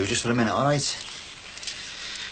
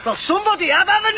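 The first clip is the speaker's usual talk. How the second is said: much higher and louder, voice cracking; screaming rage; 4 dB louder, faster than usual. Acted. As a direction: hurl it at him at full pitch. Husband screaming accusation.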